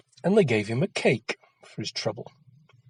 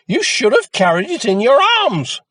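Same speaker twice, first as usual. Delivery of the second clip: higher, louder nagging voice; high loud scolding; 11 semitones higher, 9 dB louder than usual. A narrator's reading. Mother telling Jack off, high and loud. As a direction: scold him shrilly. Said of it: "screeched his mother".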